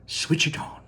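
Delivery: breathy hushed command; hushed order. Acted. Wonka tells the Oompa Loompas to switch it on, hushed. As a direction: whisper the go signal.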